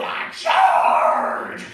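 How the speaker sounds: huge, high, full-throated shout; charging battle yell. Acted. Buffalo tells interviewer about stampede mode, charging yell.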